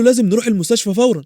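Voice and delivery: a touch of panic; mild alarm. A narrator's reading. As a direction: voice a startled woman urging haste. Startled woman urges hospital trip.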